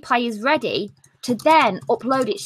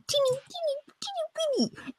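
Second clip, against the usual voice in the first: much higher and softer, a mouse-like squeak; squeaky tiny voice; 13 semitones higher, 9 dB quieter than usual. Acted. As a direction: shrink the voice to match the word teeny. Stresses how tiny the numbers are.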